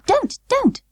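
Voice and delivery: very high, pleading little doll; upset doll. Acted. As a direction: beg it in a tiny voice. Birdie upset by barking.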